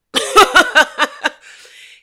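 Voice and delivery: high, loud burst of laughter. Real, not acted. Own laugh after praise.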